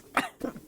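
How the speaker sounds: sharp cough from water down the wrong pipe; choking cough. Real, not acted. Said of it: "water went down the wrong tube".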